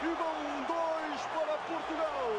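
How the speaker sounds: excited goal call, rising high; fast dramatic football commentary. Real, not acted. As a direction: shout the goal, building fast.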